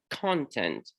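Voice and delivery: "content" said as CON-tent, slowly; the noun "content", first syllable stressed. Shown as a demonstration. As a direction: put the weight on the CON of "content". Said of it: "content with stress on the first syllable".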